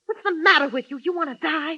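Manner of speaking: high, loud, urgent disbelief; frightened woman scolding a reckless man; alarmed pleading. Acted. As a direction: shake him out of calm. Woman fears he'll be killed.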